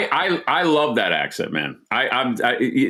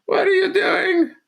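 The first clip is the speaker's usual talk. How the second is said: impression of mom. Host imitating mother seeing the video, high dismayed falsetto. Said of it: "That's my impression of my mom".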